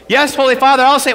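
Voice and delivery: loud, high eager yell. Retold or imitated. Priest answering the Pope far too loudly. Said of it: "why am I yelling at him".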